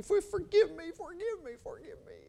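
high broken plea fading to an unvoiced whisper; imitating crying. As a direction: mimic a man begging through tears. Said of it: "he started shaking and crying".

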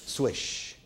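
breathy swish of a net; whispered swish. Shown as a demonstration. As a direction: hiss the swish like a basketball. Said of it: "it makes a sound that goes".

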